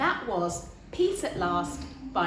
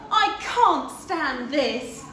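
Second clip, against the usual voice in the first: slow, higher, louder weary complaint; fed-up Mister Bear; 4 semitones higher, 6 dB louder, much slower than usual. Acted. Mister Bear, kept awake, moans slowly and loudly. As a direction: drag it out, fed up and sleepless. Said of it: "said Mister Bear".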